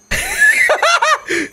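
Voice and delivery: high long laugh. Real, not acted. Viewer laughing at the frog cartoon, high laugh.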